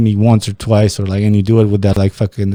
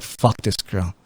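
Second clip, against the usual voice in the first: quiet inner thought; 3 semitones lower, 10 dB quieter, slower than usual. Retold or imitated. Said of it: "I was like".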